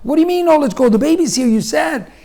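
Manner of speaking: high-pitched, incredulous arguing; frantic protest. Retold or imitated. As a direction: protest in a panicky rush. Acting out frantic argument with wife.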